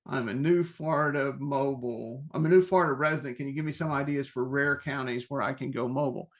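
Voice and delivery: ordinary delivery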